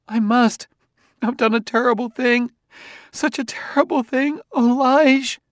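very high tearful woman's voice; distraught wailing. A narrator's reading. Wife confessing something terrible to her husband, distraught. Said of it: "She lapsed into incoherence".

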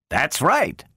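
high-pitched, surprised agreement. A narrator's reading. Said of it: "said Bogert in surprise".

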